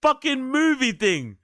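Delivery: very high, exasperated wail. Real, not acted. Reactor fed up with movie-like bragging, exasperated. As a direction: complain at the top of the pitch range.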